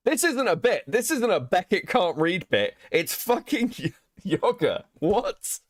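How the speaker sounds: high, exasperated insisting; indignant protest. Real, not acted. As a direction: push it up, defending yourself. Insists not a bit.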